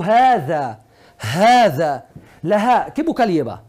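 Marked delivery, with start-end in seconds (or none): 0.0-2.1 HADA with a drawn-out first "aa"; lengthened vowel. Shown as a demonstration. Teacher asks Antonia if she notices something, lengthened vowel. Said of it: "¿tú notas algo? Yo digo".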